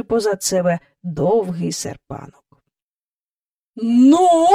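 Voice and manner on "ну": high, loud one-word prompt; rising, booming demand; droning boom. A narrator's reading. Haughty visitor prompting the family, droning boom. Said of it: "прогула вона".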